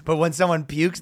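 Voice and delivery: usual speaking voice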